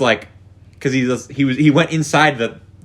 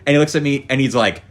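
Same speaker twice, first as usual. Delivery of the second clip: ordinary talk, nothing marked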